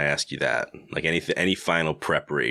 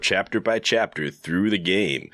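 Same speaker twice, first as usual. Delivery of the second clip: higher, upbeat show opening; podcast host intro; 4 semitones higher than usual. Acted. Host opening the finale episode, announcer style. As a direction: announce it, stretching the last word high and long.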